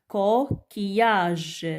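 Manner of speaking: slow Coquillage split as co - quillage; syllable by syllable. Shown as a demonstration. Teacher counting syllables for children, Coquillage slowly split. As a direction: pause after co, stretch quillage. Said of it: "Trois syllabes".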